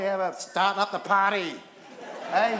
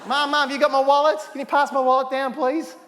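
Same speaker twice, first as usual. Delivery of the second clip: louder, higher call across the hall; 5 semitones higher, 9 dB louder than usual. Acted. Host calling loudly to mum for his wallet. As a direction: yell for mum to pass the wallet.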